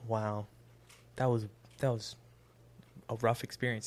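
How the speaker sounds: low, soft deadpan quote; subdued deadpan. Retold or imitated. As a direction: understate it, low and hushed. Retold girlfriend after the hospital.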